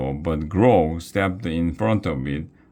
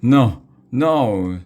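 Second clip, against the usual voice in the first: louder, higher pleading no, no; frightened cry; 6 semitones higher, 5 dB louder than usual. Acted. Wiglaf cries no while dangling from the window. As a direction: plead in panic. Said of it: "Wiglaf cried".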